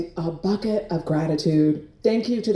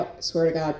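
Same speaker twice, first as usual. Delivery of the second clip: usual speaking voice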